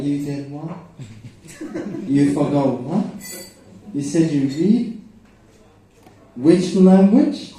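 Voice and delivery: a hint of an incredulous mother, rising; imitating mom. Mom confronts son about reading, rising outrage. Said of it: "My mom was gonna be like".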